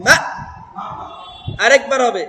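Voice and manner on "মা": মা cut off with a push at the end; pushed hamza stop. Shown as a demonstration. Quran teacher shows the class the hamza jazm, pushed abruptly. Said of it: "হামজার উপর যজম হলে ধাক্কা দিতে হয়".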